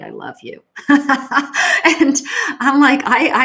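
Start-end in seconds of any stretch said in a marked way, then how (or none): 0.8-1.9 loud real laugh at herself; burst of laughter. Real, not acted. Laughs at own admission. 2.0-3.4 laughing. Real, not acted. Laughing while speaking.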